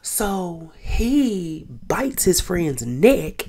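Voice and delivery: normal talking voice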